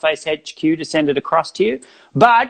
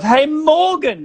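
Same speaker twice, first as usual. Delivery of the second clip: high, slow call of a name; drawn-out Hey, Morgan; mock fan call; 8 semitones higher, 3 dB louder, slower than usual. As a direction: shout it like a fan across the street. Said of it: "I get a lot of".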